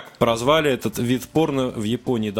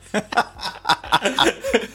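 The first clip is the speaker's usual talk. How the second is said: high breathy giggle; high laughter; 10 semitones higher than usual. Real, not acted. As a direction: giggle helplessly. Laughing at article.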